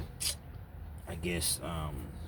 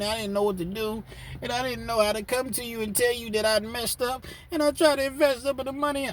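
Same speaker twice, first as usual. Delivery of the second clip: pleading wife; 13 semitones higher than usual. Retold or imitated. Wife pleading with her husband in a high voice. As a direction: beg him to forgive her.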